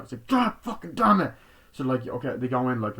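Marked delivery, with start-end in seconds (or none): 0.3-1.3 a high, loud curse burst out; explode, cursing loud and high; furious shout. Retold or imitated. Boyfriend losing it with the producer, high furious shout.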